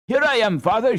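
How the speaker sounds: high, loud shout of joy; a boy half mad with joy; joyful shout. A narrator's reading. Son shouting joyfully to his waking father. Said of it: "shouted Hans, half mad with joy".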